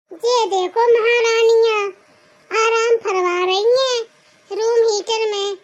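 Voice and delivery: slow, drawn-out sneer at idle queens; sarcastic, leisurely taunt; mocking sarcasm. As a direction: stretch the words out in mock admiration. Sister-in-law mocks the seated women.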